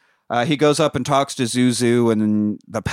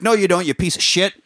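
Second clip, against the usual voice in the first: angry snap; 6 semitones higher than usual. Retold or imitated. George snaps at the kid.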